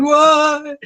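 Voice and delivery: high-pitched, excited whoop in imitation; imitated excitement. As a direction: mimic a friend getting carried away. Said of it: "when Steve's getting really excited".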